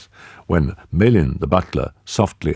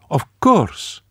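quick, higher woman's voice; woman's voice, prompt; 11 semitones higher than usual. A narrator's reading. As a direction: answer at once, falling. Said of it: "Marie-Lou said at once".

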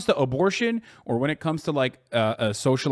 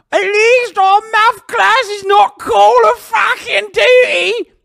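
high, loud mock British heckler; over-the-top British taunt at full volume; shouted British accent; 8 dB louder, slower than usual. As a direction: bellow it in a hammed-up British accent. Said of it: "Oi Bruv that has come in here and been like".

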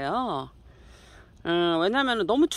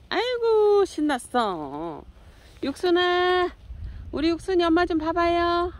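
higher, louder calling to the cats; 10 semitones higher, 3 dB louder than usual. Real, not acted. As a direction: coo and call the kitty to look at mom. Calling cats in baby talk. (고양이를 부르는 높은 애기 말투)

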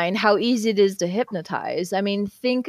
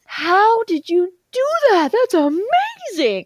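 amazed; 12 semitones higher, 4 dB louder than usual. Retold or imitated. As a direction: imitate an awestruck client, stretching the words. Retold client amazed by the trick.

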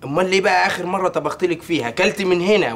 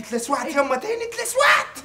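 burnt again; 10 semitones higher than usual. Acted. Son burnt in kitchen.

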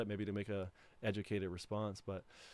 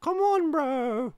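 slow stretched high yell, much louder; drawn-out high shout; 10 dB louder, much slower than usual. Acted. Streamer stretching a high yell at his friend. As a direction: drag the yell out long and high.

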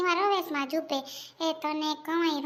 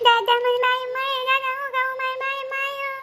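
high wailing complaint; child crying; 8 semitones higher than usual. Acted. Child crying to grandfather about being hit, high wail. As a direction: cry out high, dragging into a wail. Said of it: "બંધ કરો બે રડવાનું".

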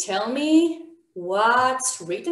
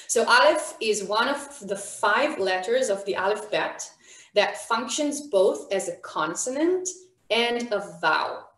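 ordinary delivery

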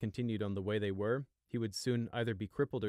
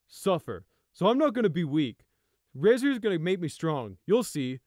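loud, intense and driven; a zealot's conviction; fanatical older brother; 9 semitones higher, 10 dB louder than usual. Acted. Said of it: "with a mad glint in his eye".

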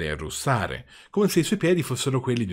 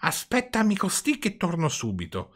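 put-on higher voice for a little old man; old man's reply; 7 semitones higher than usual. A narrator's reading. Old man tells puppet to wait below, high character voice. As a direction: call down in a lightly put-on character voice.

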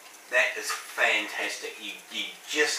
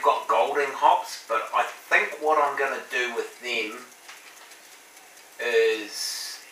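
talking with hand on jaw; thoughtful, hand under chin; chin resting on hand; 2 semitones higher than usual. Real, not acted. Seen 0: Hand cups chin and cheek from 0.9 s; mouth stays uncovered.

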